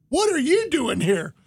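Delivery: imitated surprised reply; retold surprise. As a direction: throw the question back in surprise.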